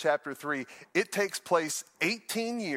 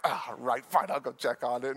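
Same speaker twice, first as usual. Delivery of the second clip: grudging sigh; 4 semitones lower than usual. Retold or imitated. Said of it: "So I'm like".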